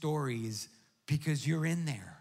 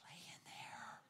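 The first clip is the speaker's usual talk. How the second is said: hushed whisper; 21 dB quieter than usual. Retold or imitated. Pastor telling of the limp dog, whispering. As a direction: breathe it out, defeated.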